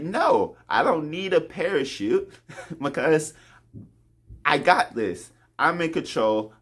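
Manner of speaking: high-pitched, overconfident protest; mock cocky refusal. Skydiver refusing the pilot's parachute, cockily insistent. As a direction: play a stubborn daredevil waving off help.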